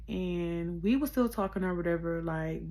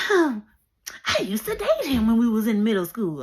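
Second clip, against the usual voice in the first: high, louder gushing mom voice; giddy mom; 4 semitones higher, 6 dB louder than usual. Retold or imitated. Mom bragging she dated his uncle, gushing. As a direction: gush like a schoolgirl. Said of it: "she was like".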